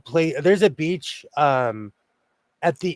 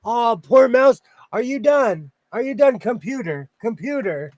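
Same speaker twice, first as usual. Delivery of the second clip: coaxing a stuck computer, high; pleading sweetly with the mouse; talking to the mouse; 6 semitones higher than usual. Pretend. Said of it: "I'm gonna figure out".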